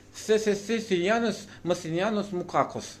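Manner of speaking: hammed-up Castilian lisp on every word; mock Spanish lisp. Comic speaker mocking how Spanish sounds, lisping Spanish accent. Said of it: "Spanish say".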